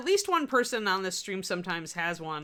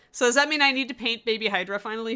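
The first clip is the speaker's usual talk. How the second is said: ordinary talk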